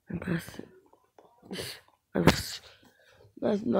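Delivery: breathy whisper to himself. Real, not acted. Gamer whispering to himself over a strange sight. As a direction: whisper it in wonder. Muttering to self while playing.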